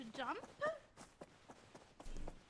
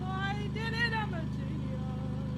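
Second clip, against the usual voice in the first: loud proud gloating; triumphant boast; 3 semitones higher, 8 dB louder, slower than usual. Real, not acted. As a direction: crow about the win. Triumphant boast after success.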